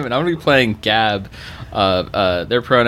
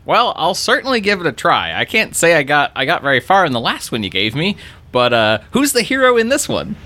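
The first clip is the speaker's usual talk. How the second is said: higher, eager hype-man voice; [name] in character; 7 semitones higher than usual. Acted. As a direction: lean in, eager and curious. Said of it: "and goes".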